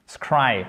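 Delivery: Scry alone, full vowel, falling; stressed syllable. Shown as a demonstration. Teacher shows what follows the apostrophe, stressed Scry. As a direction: land firmly on Scry. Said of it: "c'est ce qui est accentué".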